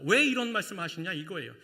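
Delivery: normal talking voice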